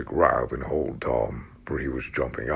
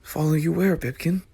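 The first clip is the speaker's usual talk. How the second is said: put-on boy voice calling.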